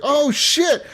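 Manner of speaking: high surprised exclamation; pleasantly shocked oh shit. Retold or imitated. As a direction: react to an unexpected gift. Retold surprise at the gift.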